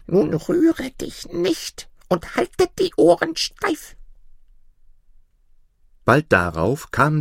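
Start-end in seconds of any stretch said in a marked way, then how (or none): 0.1-3.9 put-on very high, slow old woman voice; high, slow little granny; ancient granny instructing. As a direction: give orders in a tiny crone voice, drawn out. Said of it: "Sie versteckte ihn unter dem Bett und sagte".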